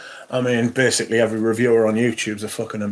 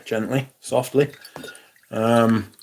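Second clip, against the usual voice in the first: ordinary talk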